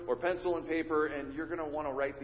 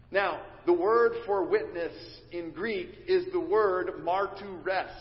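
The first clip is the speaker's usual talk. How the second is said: ordinary talk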